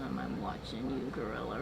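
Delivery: low, quiet threat; mock menace. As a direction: drop way down like a vengeful stalker. Man vows revenge on gorilla.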